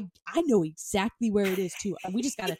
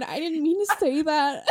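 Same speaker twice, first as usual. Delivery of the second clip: laughing quote; 6 semitones higher, 3 dB louder than usual. Retold or imitated. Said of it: "genuinely was like".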